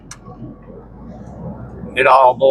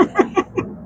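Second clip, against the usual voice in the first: breathy laugh; 6 dB quieter than usual. Real, not acted. Laughs at the favor claim.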